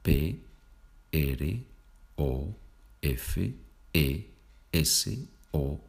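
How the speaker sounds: slow letter-by-letter spelling with pauses; spelled out. Shown as a demonstration. Teacher spelling profesor for Spanish learners, spelled out. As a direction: say each Spanish letter separately, slowly. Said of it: "¿Cómo se deletrea profesor?".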